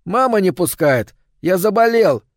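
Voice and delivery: high, lightly voiced boy calling out; sick boy calling out. A narrator's reading. Sick boy calls from his window to the girl outside. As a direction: call through the glass to her.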